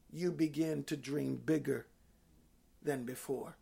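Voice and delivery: low, hushed, measured phrases; quiet pulpit encouragement with pauses; soft reflective preaching. Real, not acted. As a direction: bring it down gently and let it land.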